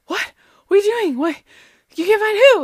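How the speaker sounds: high, loud startled questions; alarmed disbelief. Retold or imitated. Wife startled awake, acted retelling.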